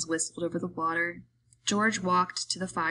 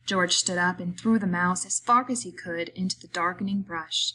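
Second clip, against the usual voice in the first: ordinary delivery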